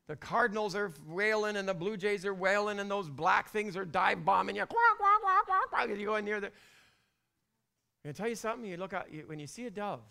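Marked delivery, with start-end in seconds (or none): 4.7-5.9 high, loud squawking quacks; mock bird squawk. Imitating noisy birds.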